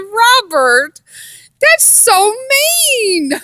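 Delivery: high, slow, laughing through the words; laughing protest. Retold or imitated. Wife laughing at husband's joke, high and drawn out. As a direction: scold him while cracking up. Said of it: "I said".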